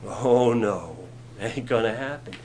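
low, fading mock doubt; a hint of a skeptical disciple. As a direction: drop low and shrug it off. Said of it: "we'd be like".

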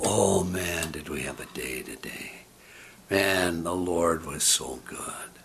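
low, soft report of a wonderful day; hushed awe. Retold or imitated. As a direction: slip into a quiet, awed murmur. Retelling joyful report after church.

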